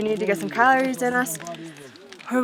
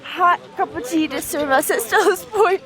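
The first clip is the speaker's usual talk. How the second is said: excited; 5 semitones higher, slower than usual. Real, not acted. Delighted at tea on summit.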